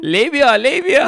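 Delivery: put-on Persian accent, high and drawn out. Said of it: "with a Persian accent".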